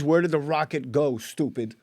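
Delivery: normal talking voice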